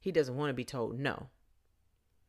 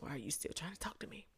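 normal talking voice

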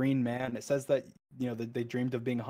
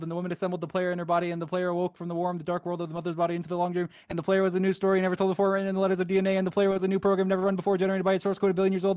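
fast, higher, breathless poem reading; rushed reading; 6 semitones higher, 5 dB louder, faster than usual. Real, not acted. Gamer racing the End Poem credits, hurried frantic reading. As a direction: cram the words before they vanish. Rushing to keep up with scroll.

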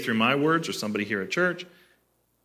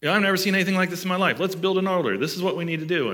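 high, loud, rushed chatter; mock terrified babbling; 7 semitones higher, 4 dB louder, faster than usual. Preacher acts terrified disciples on the mountain, high frantic babbling. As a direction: imitate flustered disciples blurting out plans at speed. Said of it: "like, terrified".

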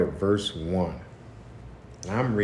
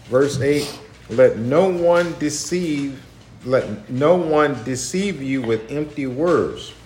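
slow, raised preaching read; scripture reading; 4 semitones higher, 4 dB louder than usual. Acted. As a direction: read the verses out like a sermon. Reads scripture aloud.

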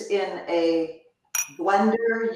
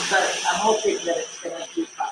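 ordinary talk

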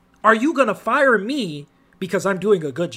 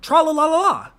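carefree singsong; 8 semitones higher, 4 dB louder than usual. Retold or imitated. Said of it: "So he's just like".

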